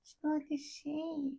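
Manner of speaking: high, very soft and drawn out; soft, high Goldblum-style aside; Jeff Goldblum. Acted. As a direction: murmur it high and slowly. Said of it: "just had a Jeff Goldblum moment".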